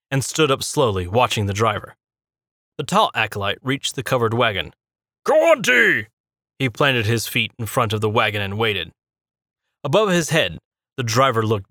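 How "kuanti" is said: high, loud demanding call. A narrator's reading. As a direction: shout the name at the wagon. Acolyte calls at the wagon.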